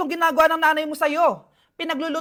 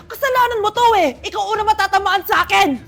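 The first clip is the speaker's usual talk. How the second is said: loud, high-pitched fury; irate mother's outburst; angry blaming; 4 semitones higher, 6 dB louder than usual. Acted. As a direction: lash out and threaten, shouting. Mother turns rage on teacher.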